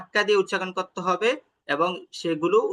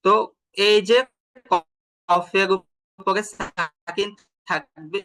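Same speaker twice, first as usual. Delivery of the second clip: usual speaking voice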